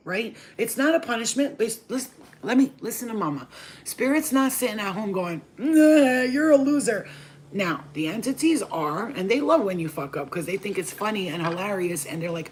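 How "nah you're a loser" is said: loud, high scornful jeer; a spirit scoffing at a loser; mocking dismissal. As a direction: sneer it down at her.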